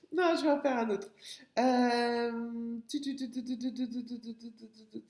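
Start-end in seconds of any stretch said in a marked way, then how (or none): 2.9-5.1 rapid tu-tu filler. Real, not acted. Teacher searching for another word, idle tu-tu-tu filler. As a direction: run of quick, light "tu tu tu" sounds.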